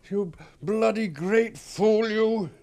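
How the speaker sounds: loud, high, slow curse at himself; drawn-out shout of frustration; angry self-reproach. Acted. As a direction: berate yourself at full voice. Berates himself once alone.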